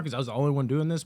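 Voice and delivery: normal talking voice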